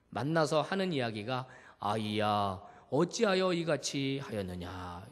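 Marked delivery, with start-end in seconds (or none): 1.8-5.1 gentle rebuke. Retold or imitated. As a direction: calmly ask the child why. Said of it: "요 정도입니다". (부드럽고 느린 꾸짖음)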